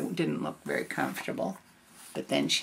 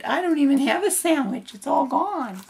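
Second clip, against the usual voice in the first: high, loud little bear; baby bear voice; 8 semitones higher, 3 dB louder than usual. Acted. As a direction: whine like a tiny bear cub. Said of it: "the baby bear said".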